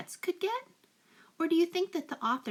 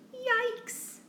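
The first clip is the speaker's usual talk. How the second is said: much higher squeaky yelp of fright; shocked yell; 13 semitones higher than usual. A narrator's reading. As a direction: yelp it out in alarm. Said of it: "He looked at his pets and shouted".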